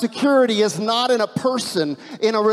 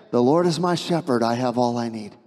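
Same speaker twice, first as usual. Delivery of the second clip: calm affirmation; 7 semitones lower than usual. Shown as a demonstration. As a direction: settle the worry with the verse. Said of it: "you need to pause and say".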